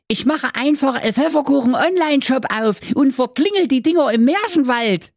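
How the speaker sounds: very high goofy character voice; Hans voice. Acted. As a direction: pitch it way up like a scheming cartoon kid. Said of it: "hatte eine bessere Idee".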